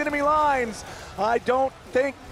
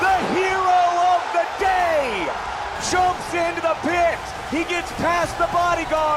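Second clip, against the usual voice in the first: excited shout; 7 semitones higher than usual. Real, not acted. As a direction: yell it out as the steal lands. Caster hype over Baron steal.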